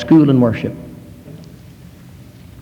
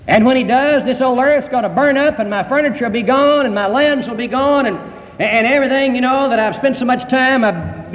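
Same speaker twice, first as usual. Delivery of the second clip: higher-pitched, running-on mock complaint; protesting churchgoer; 6 semitones higher than usual. Churchgoer objecting to the preacher about losing everything, protesting. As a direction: pile up the worries in a raised, incredulous voice. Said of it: "And you say".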